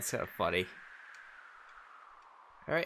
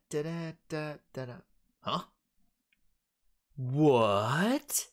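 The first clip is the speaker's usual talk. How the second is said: ordinary delivery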